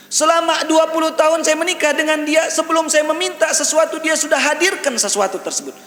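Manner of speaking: high, sustained declamation; loud, high preacher's testimony; impassioned preaching. Retold or imitated.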